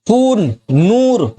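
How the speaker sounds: खून and नूर with the ऊ stretched long, slow; long vowel drawn out. Shown as a demonstration. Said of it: "ये सब खींच के पढ़ा जाता है".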